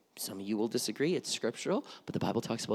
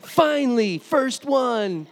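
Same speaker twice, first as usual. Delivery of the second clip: much higher and louder, crowing with glee; gleeful kid; 10 semitones higher, 11 dB louder, much slower than usual. Retold or imitated. Child gloating as sister finally gets spanked, gleeful shout. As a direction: cheer as if you finally won. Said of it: "And I was just like".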